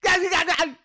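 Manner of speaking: high excited shouting. Acted. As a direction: yell it out with glee. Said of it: "If you're gonna shout".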